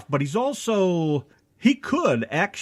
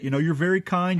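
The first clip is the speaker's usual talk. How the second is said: ordinary talk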